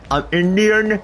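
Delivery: mock Indian accent. Retelling what classmates were saying, mock Indian accent. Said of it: "doing some racist accents".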